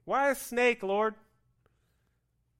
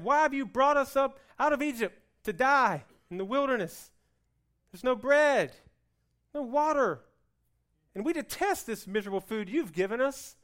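whiny complaint; 6 semitones higher, 8 dB louder than usual. Acted. Israelites complaining to Moses.

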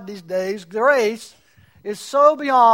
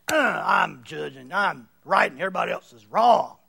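smug judgmental voice; 8 semitones lower than usual. Retold or imitated.

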